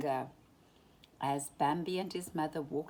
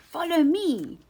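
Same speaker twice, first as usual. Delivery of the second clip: high, loud call to follow; shout; 10 semitones higher, 11 dB louder than usual. A narrator's reading. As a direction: rabbit shouts to lead the pack. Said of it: "shouted Thumper".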